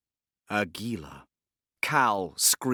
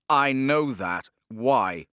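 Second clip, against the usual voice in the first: louder, slow, irritated demand; 3 semitones higher, 6 dB louder, much slower than usual. A narrator's reading. Father pressing his daughter for answers, irritated and louder. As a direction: bite off each word, slowly.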